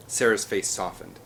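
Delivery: ordinary delivery, nothing marked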